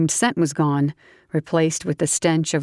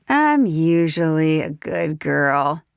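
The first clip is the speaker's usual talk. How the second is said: tipsy southern woman; slower than usual. Acted. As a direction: play the tipsy belle teasing him. Grace's voice.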